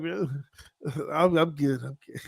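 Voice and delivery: brushing off emotion through a laugh. Retold or imitated. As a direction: chuckle while insisting you're fine. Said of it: "I was like".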